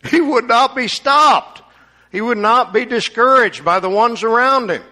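high, loud preaching; fervent preaching. Real, not acted. Preacher stressing Bartimaeus would not stop, loud. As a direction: drive the point home with fire. Preacher presses point with force.

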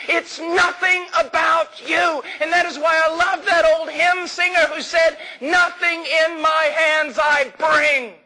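high, impassioned pulpit cry; full-force preaching. Real, not acted. Preacher exalting Christ to the congregation, at full force.

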